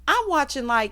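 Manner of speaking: ordinary delivery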